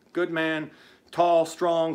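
normal talking voice

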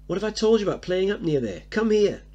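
high, loud, fast telling-off; furious mother scolding her young son; angry scolding. Acted. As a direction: scold the boy in sudden fury. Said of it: "notice the difference suddenly".

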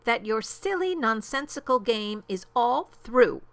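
indignant, emphatic dismissal; snapping. A narrator's reading. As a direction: snap out a final verdict. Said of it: "snapped the big kangaroo".

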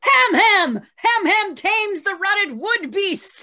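shouting own name in triumph, slowly and loud; heroic shout. Acted. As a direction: bellow the name like a battle cry. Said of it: "are you shouting your name while you do this".

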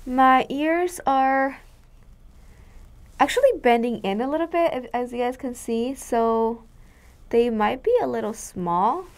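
muffled behind mask; speaking with covered mouth; through a face mask. Real, not acted.

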